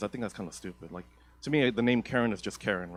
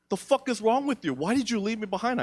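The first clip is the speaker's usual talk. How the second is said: put-on higher angry girlfriend yelling; mock angry girlfriend; 10 semitones higher than usual. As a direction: snap at him like the ditched girlfriend.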